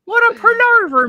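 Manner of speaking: rustic West Country farmer voice; West Country accent. Acted. Host fussing over a lost email in West Country accent. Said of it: "why I started talking like that".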